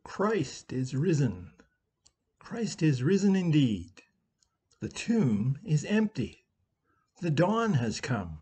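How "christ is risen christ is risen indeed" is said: ordinary talk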